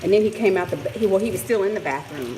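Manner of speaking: ordinary delivery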